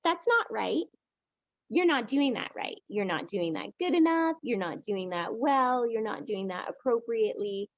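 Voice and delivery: high nitpicking critic voice; nagging monkey. Acted. Teacher voicing the critical monkey mind, high and nagging. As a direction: nag like a fussy inner critic. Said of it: "the monkey's like".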